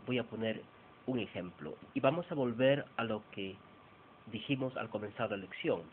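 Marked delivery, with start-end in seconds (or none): none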